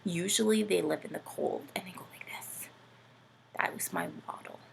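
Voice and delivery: soft secretive whisper. Pretend. Whispering a penguin hint to the children.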